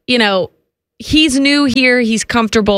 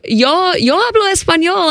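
speaking Spanish; 8 semitones higher, slower than usual. Retold or imitated. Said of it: "talking in Spanish with him".

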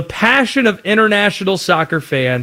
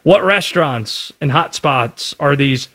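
normal talking voice

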